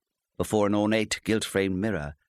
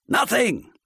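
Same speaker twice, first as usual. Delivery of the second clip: loud, high shout; 5 dB louder than usual. A narrator's reading. Husband shouting at his wife, loud. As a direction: shout the word down at her. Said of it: "he shouted".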